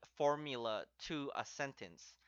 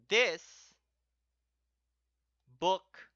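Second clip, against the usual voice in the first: "this" and "book" each louder and stressed; literal word by word; 3 semitones higher, 8 dB louder than usual. Shown as a demonstration. Teacher gives the literal word order, each word stressed.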